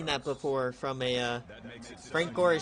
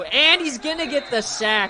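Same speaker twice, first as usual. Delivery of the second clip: much higher, louder burst of excitement; excited shout; 11 semitones higher, 11 dB louder than usual. Real, not acted. Gamer calling his defense's big sack, excited shout.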